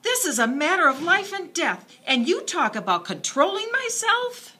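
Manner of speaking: high, loud, near tears; tearful protest. A narrator's reading. Girl protesting to her father, loud and tearful. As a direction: plead through rising tears. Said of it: "Tears ran down her cheeks".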